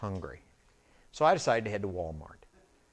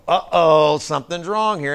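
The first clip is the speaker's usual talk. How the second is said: mock alarm; 5 semitones higher, 13 dB louder than usual. Imagined bank staff spotting money.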